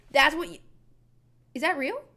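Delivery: ordinary talk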